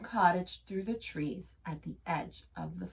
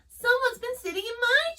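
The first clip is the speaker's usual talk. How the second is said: high, loud squeaky little bear; 6 dB louder than usual. A narrator's reading. Baby Bear finds his chair, high and squeaky. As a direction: squeak it like a tiny cub. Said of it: "squeaked Baby Bear".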